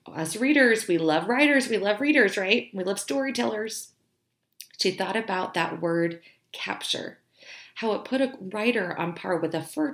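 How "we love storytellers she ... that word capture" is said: ordinary talk, nothing marked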